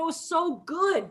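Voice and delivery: ordinary talk, nothing marked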